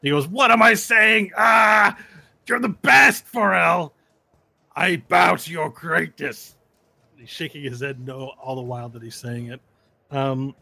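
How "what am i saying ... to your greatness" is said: high, loud, strained and slowed; forced grudging praise. Acted. Compelled fighter bowing to Varel, high loud angry praise. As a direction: fight the words as they come out.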